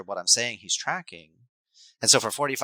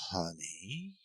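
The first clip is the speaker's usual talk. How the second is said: much softer, very slow soothing; a parent too calm for an upset kid; soft drawn-out soothing to an agitated child; mismatched calm; 5 semitones higher, 5 dB quieter, much slower than usual. Retold or imitated. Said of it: "such a mismatch between those two".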